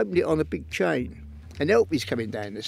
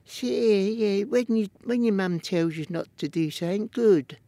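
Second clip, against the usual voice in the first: squeaky, higher voice, half-mimicked; Ronnie's squeaky voice; 6 semitones higher than usual. Ronnie Kray lecturing a young boy in a squeaky voice. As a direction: put on a squeaky gangster voice, not overdone. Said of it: "squeaky voice".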